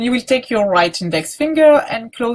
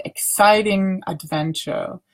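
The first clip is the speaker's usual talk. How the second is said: ordinary delivery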